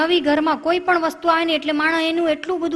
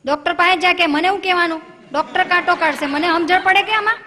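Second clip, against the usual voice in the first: louder, higher annoyed snapping; irritated scolding; 5 semitones higher, 5 dB louder than usual. Retold or imitated. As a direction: brush her off impatiently. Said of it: "tone જ બદલાઈ ગયો". (કંટાળેલા પતિનો ઊંચા સાદે ઠપકો)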